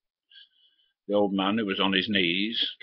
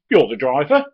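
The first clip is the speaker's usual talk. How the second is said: higher, louder snap from the youth; retold sharp retort; 6 semitones higher, 9 dB louder than usual. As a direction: bark it back at the driver. Youth insists in the quarrel.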